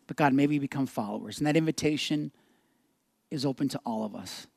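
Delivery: low, hushed pastoral prayer. Real, not acted. Pastor leading the church in closing prayer, reverent. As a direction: pray gently with long pauses.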